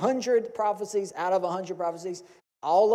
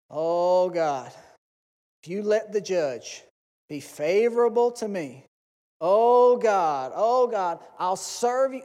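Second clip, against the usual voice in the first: mock pleading prayer; 3 semitones higher, 8 dB louder, slower than usual. A defendant bargaining with God over the judge, rising mock cry.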